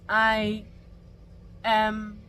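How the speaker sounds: usual speaking voice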